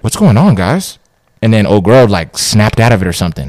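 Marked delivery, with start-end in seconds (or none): 0.0-1.0 higher, louder nervous check-in; nervous question. Retold or imitated. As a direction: ask the staring strangers, rattled. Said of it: "He was like".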